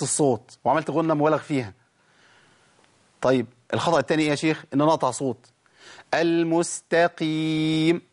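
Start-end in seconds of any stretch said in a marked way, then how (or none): 6.1-8.0 المستقيم with the final م held long and nasal; stretched mim. Shown as a demonstration. Teacher demonstrating the held mim of المستقيم. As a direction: draw out the م in المستقيم steadily. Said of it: "عملت شدة".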